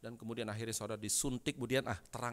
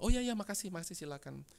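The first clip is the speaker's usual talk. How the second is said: acted eager thanks; 8 semitones higher than usual. Father welcoming the preacher's promised visit, eager acted thanks. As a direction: mimic a man readily agreeing.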